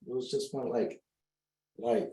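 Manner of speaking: ordinary talk, nothing marked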